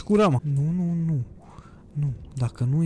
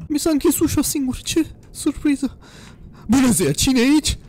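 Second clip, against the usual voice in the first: high, raised sing-out voice; nervous calling out; 9 semitones higher, 4 dB louder than usual. Real, not acted. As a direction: call out high and uneasy into the house. Door shuts, calls out nervously.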